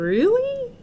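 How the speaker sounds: very high, small voiced Elmer; squeaky doubt. A narrator's reading. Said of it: "thought Elmer".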